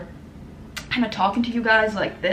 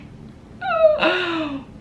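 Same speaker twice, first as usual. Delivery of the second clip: real laugh breaking into a cry; laughing through tears; tearful laugh; 10 semitones higher than usual. Real, not acted. Overwhelmed thanking viewers, crying laugh. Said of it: "I'm actually getting emotional".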